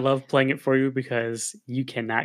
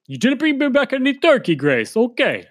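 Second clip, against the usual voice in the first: much higher, louder voice of a bossy dog; 11 semitones higher, 5 dB louder than usual. Pretend. Dog Megan scolds Grace over the turkey, in a high put-on voice. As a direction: squeak it out like a spoiled poodle.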